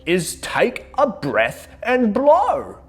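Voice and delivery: slow high moose instruction. A narrator's reading. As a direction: slowly show how to blow. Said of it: "he said".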